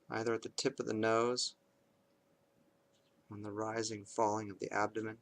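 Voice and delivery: calm, measured meditation voice with long pauses; guided meditation. Acted. Parent leading kids through bedtime meditation, calm and measured. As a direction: guide them unhurriedly into awareness of the breath, leaving space between phrases.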